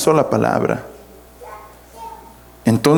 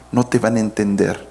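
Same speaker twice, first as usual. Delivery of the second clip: normal talking voice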